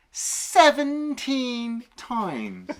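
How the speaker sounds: very slow, high, drawn-out disbelief; incredulous. Real, not acted. Hammering home the reuse count, slow incredulity. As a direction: stretch each word out high and incredulous.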